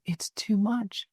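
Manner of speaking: soft, drained retelling of a patient; retold overwhelmed plea. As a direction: act out being overwhelmed, quietly.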